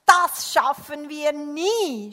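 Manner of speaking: loud, slow wail of defeat; exasperated, rising to a drawn-out last word; puffing despair. A narrator's reading. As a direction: groan it out loudly, overwhelmed. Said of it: "schnaufte der Mann".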